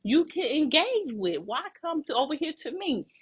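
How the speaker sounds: high, incredulous why are you talking to me; high exasperated retelling. Retold or imitated. Retelling how she confronted the coworker, high and exasperated. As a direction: throw the question back, high and fed up. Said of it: "I'm like".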